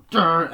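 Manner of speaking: short loud high "durr"; imitated title sting. The title hits on screen, mimicked music sting. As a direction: punch out a dramatic film sting. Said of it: "and it just goes".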